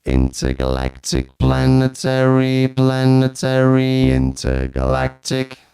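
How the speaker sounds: robotic chant on held notes; vocoder voice. Shown as a demonstration. Reviewer testing vocoder with old chestnut, robotic chant. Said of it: "to vocoding".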